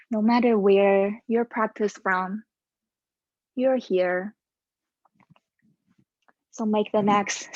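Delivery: calm, steady yoga cueing voice; yoga instruction. Acted. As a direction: guide the class through each pose. Leads a yoga class.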